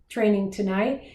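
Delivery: normal talking voice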